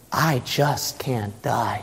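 quiet inner vow; hushed resolve. Acted. Preacher voicing the inward man to listeners, hushed.

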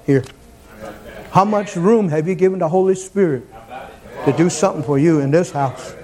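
ordinary talk, nothing marked

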